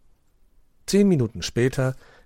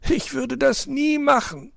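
groaning; 4 dB louder, slower than usual. A narrator's reading. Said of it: "stöhnte".

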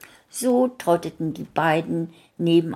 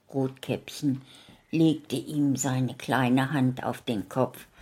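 plain reading voice

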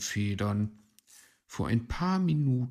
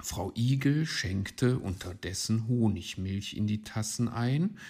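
ordinary delivery

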